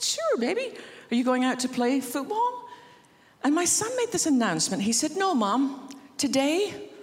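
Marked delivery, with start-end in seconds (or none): 0.0-2.7 put-on a doting mother humouring her boy; sweet mom voice. Mother humouring her ten-year-old son, high and sweet. 5.2-6.9 lightly voiced earnest little boy, a bit higher; a hint of a solemn ten-year-old; boy's announcement. Son telling his mom he's leaving home, mild solemn boy voice.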